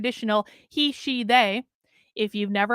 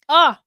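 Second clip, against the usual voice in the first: loud gasp after choking; relieved gasp; 3 semitones higher, 8 dB louder than usual. Real, not acted. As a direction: gasp for air after coughing. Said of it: "I hate when that happens".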